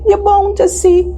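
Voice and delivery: high, drawn-out coaxing; pleading protest. Acted. Cassie argues the moonlight is enough, high-pitched protest. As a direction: stretch the words out, rising high in protest. Partner protests against going out.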